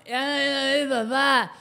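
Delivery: lightly voiced mom hurrying dad along; mock fussy mother, pitch up; imitating the mother. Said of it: "y mamá".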